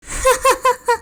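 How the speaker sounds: sudden high-pitched laugh; high pained laugh. Acted. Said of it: "began to laugh".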